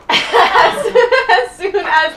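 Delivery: real laugh breaking into the words; cracking up. Real, not acted. Host cracks up at her own news joke, laughing. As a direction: start the line through high, helpless laughter.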